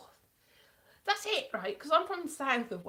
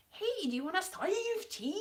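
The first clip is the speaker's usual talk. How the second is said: high-pitched mock northern English accent; imitated northern accent; 9 semitones higher than usual. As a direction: mimic a shaky attempt at northern English, pitched up. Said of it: "But the accent was a little bit".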